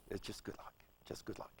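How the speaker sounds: whispered good luck, fading away; hushed reflection. Real, not acted. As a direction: let it trail off to a whisper. Trailing reflective repetition.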